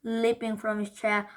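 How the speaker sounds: ordinary delivery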